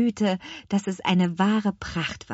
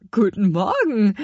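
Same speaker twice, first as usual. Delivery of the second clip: put-on louder, puzzled old lady's reply; a hint of an astonished old woman; old woman surprised; 6 dB louder than usual. Old woman answering strange children, mildly surprised. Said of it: "antwortete die alte Frau erstaunt".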